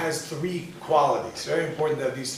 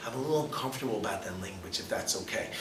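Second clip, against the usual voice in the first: lower, softer, almost whispered apology; soft polite request; 6 semitones lower, 9 dB quieter, faster than usual. Retold or imitated. To a coworker who curses, a soft hushed request. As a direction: ease it out gently, trailing to a whisper.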